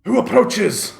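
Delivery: guard challenging. Acted.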